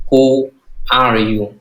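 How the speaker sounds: very slow, each word drawn out; slow teacher demo of the question; slow model reading. Shown as a demonstration. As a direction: model the question slowly for students. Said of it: "Lo nói chạy tiền".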